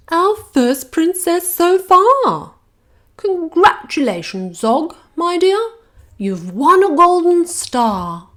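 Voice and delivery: high, loud, slow teacher dragon; a grand schoolmistress dragon instructing her class; Madam Dragon. Acted.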